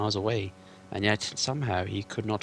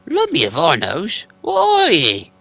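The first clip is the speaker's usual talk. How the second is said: much higher, louder troll voice; trolls asking; 8 semitones higher, 12 dB louder, slower than usual. Acted. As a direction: shout the question like a puzzled troll.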